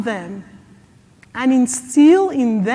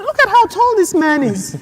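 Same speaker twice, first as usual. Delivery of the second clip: usual speaking voice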